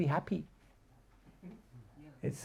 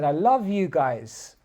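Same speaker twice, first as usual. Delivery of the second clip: cheerful fan; 5 semitones higher, 5 dB louder than usual. Retold or imitated. Stranger in Edinburgh praising the chanters, higher and louder. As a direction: gush like a delighted passer-by. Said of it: "someone came up to me and he said".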